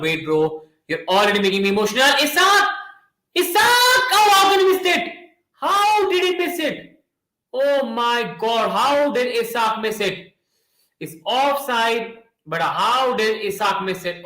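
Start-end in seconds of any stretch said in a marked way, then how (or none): 2.2-6.9 much higher and louder cry of disbelief; stunned commentator shouting at a missed chance; disbelieving shout. Real, not acted. Striker misses a big chance. 7.5-14.2 slow disbelief. Real, not acted. Replaying the miss in disbelief.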